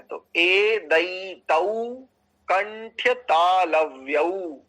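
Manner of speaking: ए दई तऊ drawn out very slowly; slow sutra demo. Shown as a demonstration. Teacher sounding out the sutra, slow. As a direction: stretch each syllable of ए दई तऊ. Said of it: "ऐसा".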